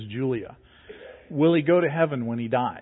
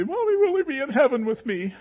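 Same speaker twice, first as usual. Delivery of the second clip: put-on high child's voice, pleading; child asking; 12 semitones higher than usual. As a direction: ask it like a little kid hoping. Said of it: "and they say".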